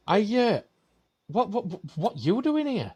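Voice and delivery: high, hesitant stammer of disbelief; baffled barman. Acted. Barman quizzes a talking duck at his bar, baffled.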